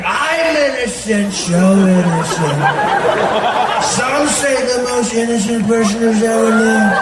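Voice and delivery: loud, slow, stretched-out boasting; cartoon villain suspect. Villain in a lineup pleading innocence, loud drawn-out impression. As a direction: drag every word out like a grandiose cartoon villain. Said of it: "he belongs in an episode of Scooby-Doo".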